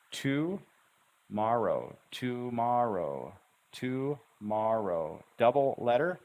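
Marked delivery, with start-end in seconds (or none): 0.1-5.2 "Tomorrow" very slowly split as "to... mar-row"; syllable cut. Shown as a demonstration. Teacher showing learners the syllable cut, slowly split. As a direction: stretch each syllable of "Tomorrow" with a clear cut. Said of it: "easy to find the syllable cut there".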